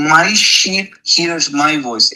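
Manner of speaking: MY stretched out slowly before "sheep"; slow, emphatic "My sheep"; stress on MY. Shown as a demonstration. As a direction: lean long and hard on MY. Preacher stressing whose sheep they are.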